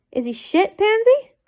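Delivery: teasing, mocking question; mock him with a sharp rise. A narrator's reading. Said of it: "Theo asked mockingly".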